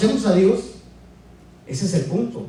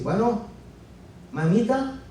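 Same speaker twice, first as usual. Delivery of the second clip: lightly acted phone greeting, pitched up; son al telefono con la madre; 8 semitones higher than usual. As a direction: imitate calling mum, higher voice. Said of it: "le decimos así".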